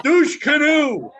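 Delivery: high, slow, teasing sing-song; drawn-out mock taunt. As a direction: stretch it out to mock him. Mocking the nickname, drawn out.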